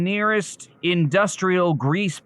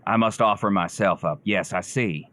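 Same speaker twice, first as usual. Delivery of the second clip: mock preacher; 7 semitones lower than usual. [name] solemnly vows to sacrifice himself like a preacher. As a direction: play the pious martyr, low and earnest.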